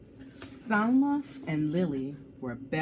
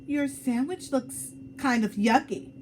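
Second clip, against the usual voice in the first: blurted out; 6 semitones higher, 3 dB louder than usual. Acted. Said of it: "she blurted out".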